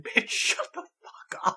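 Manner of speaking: whispering a rude order to a loud stranger. Retold or imitated. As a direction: retell the whispered telling-off. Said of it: "I said".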